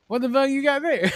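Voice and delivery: high, startled cry of disbelief; shocked outburst. Retold or imitated. Imitating the dog owner spotting the hand, high and startled. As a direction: react as if the dog dug up a hand.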